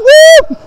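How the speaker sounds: high mock church whoop; old-school shout whoop. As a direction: imitate a worshipper's piercing woo.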